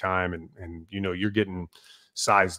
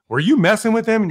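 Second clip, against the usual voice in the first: accusing question; 10 semitones higher, 6 dB louder than usual. Retold or imitated. Senior guy accusing junior.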